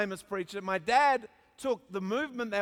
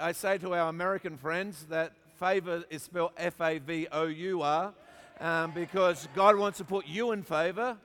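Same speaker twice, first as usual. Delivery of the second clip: ordinary delivery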